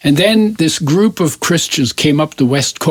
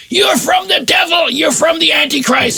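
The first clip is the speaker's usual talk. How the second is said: high-pitched, frantic accusing shout; top-of-voice yell. Retold or imitated. Man at coffee house denouncing the newcomer, yelling at the top of his voice. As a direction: shriek it like a zealot denouncing a heretic. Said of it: "yelled at the top of his voice".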